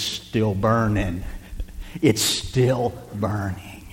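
very slow, soft, almost whispered; slow hush. Acted. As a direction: let the words burn slowly in a hush. Slow quiet repetition of burning.

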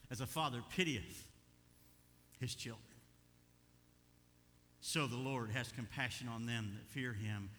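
ordinary delivery

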